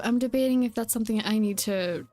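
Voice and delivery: ordinary delivery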